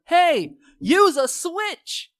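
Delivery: high, loud retold yell; shouted instruction, last words stretched. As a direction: call out urgently to a fighter. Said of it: "yells at him".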